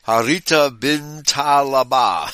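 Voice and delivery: slow, drawn-out read of a difficult name; slow careful reading. Shown as a demonstration. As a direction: sound it out slowly. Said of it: "have to read 'em slowly too".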